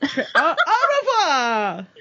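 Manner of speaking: a slow, laughing "au revoir" in a hammed-up French accent; mock French farewell. Game master ends the scene with a slow, laughing mock French "au revoir". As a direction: drawl the "au revoir" while laughing. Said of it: "That's what French people sound like".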